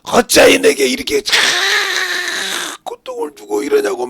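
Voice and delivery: high, loud anguished reproach; acted wail of blame, easing in volume; resentful complaint to God. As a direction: cry out in imitated grievance. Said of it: "원망을 합니다".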